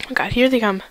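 normal talking voice

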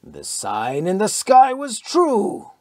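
slow, high and loud; slow loud triumphant cry; fanatic exultation. A narrator's reading. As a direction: proclaim it like a prophet vindicated.

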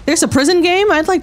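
high excited question; excited surprise. Real, not acted. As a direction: perk up, thrilled. Excited discovery.

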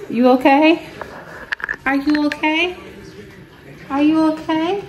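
high, lilting check on a little one. Real, not acted. Mom to her baby who just fell, concerned baby talk.

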